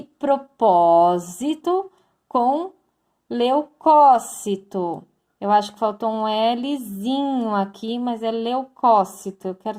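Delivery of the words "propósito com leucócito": slow example words. Shown as a demonstration. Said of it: "teacher sounds out example words".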